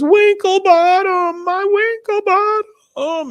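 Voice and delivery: very high, loud, drawn-out lament; mock anguished wail. [name] mourning her missing gnome, hammed-up wailing. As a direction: wail it slowly in an exaggerated high voice. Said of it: "she's just crying".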